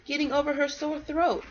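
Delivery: put-on lively read-aloud for kids; storybook reading. A narrator's reading. Parent reading a bedtime story to kids, lively storybook voice. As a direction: read it like a picture book to children.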